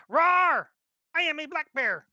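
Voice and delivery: shouted high mock bear roar; hammed-up bear shouting its name. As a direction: roar it out big and silly. GM voicing the bear on map.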